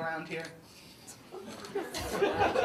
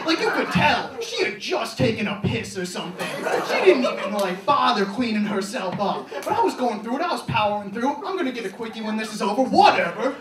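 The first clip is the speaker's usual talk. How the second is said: crude story shouted high and very loud; yelling; 7 semitones higher, 10 dB louder than usual. Retold or imitated. Shouting gross stories at a campus tour group, loud. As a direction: bellow it so the whole tour group hears. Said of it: "started yelling".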